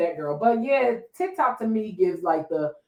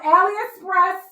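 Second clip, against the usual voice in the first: mock squealed confession; 10 semitones higher, 5 dB louder than usual. Podcaster mocking influencer who bought cheap, high squeal. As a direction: squeal it out like a caught faker.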